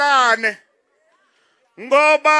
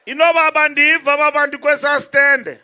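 ordinary delivery

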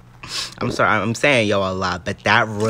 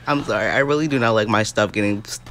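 normal talking voice

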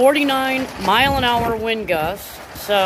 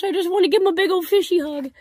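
much higher and fast, gushing; 9 semitones higher, faster than usual. Real, not acted. Gushing to her koi about a fishy hug, high and fast. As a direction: gush like you want to squeeze them.

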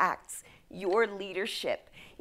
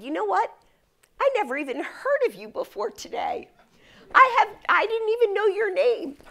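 retold fed-up outburst, pitched up; 9 semitones higher, 4 dB louder than usual. Friend's husband confronting the self-important speaker at dinner, fed up. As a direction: act out a guest who has had enough. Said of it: "had had enough".

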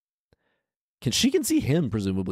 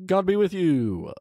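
mock call out; 10 semitones higher than usual. Man calling out to giants.

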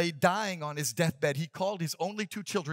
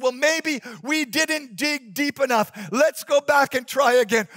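put-on encouraging older brother; 6 semitones higher, 7 dB louder than usual.

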